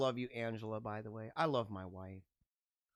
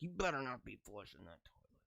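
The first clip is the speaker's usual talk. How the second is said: put-on low, soft, menacing little boy; a toddler guarding the toilet, quietly threatening; son's warning; 7 semitones lower, 14 dB quieter than usual. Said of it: "He's like".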